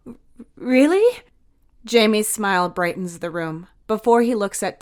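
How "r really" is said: delighted. A narrator's reading. Child delighted.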